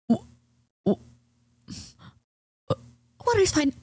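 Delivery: high, halting stutter on the w before the words come out; nervous stutter, forcing out "water is fine"; nervous stammer. Retold or imitated. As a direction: stumble over it like you're terrified of the waiter. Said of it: "Like that, word per word".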